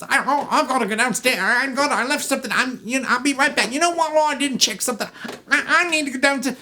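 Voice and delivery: high, loud stammering excuses; flustered groom. Acted. Groom making excuses to leave his honeymoon, flustered.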